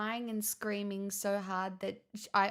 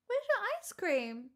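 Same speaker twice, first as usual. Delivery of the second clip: much higher, sing-song pet voice; 10 semitones higher than usual. Real, not acted. Cooing at her dog Pixel about ice cream, high baby talk.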